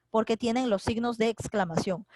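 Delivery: ordinary talk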